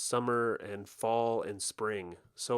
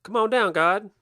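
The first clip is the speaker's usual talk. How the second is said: calling out; 9 semitones higher, 10 dB louder than usual. Pretend. Calling the gods down.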